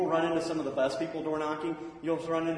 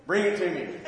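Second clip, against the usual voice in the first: loud, higher, laughing through it; calling out with a laugh; 4 semitones higher, 6 dB louder than usual. Retold or imitated. Man on the toilet asking the teen for his pamphlet, laughing. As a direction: call out, amused.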